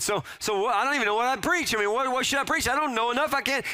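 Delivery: high, fast, flustered excuses; backpedaling young self. Retold or imitated. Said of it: "I'm backpedaling the whole way".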